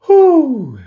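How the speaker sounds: high loud exhale after the exercise; breathless whew. Real, not acted. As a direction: blow it out, winded and relieved. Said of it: "very out of breath".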